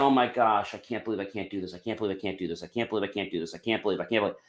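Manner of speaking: hushed, rushed fretting; anxious self-talk. Retold or imitated. Person stuck on a problem, quiet anxious muttering. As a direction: mutter it over and over in worry.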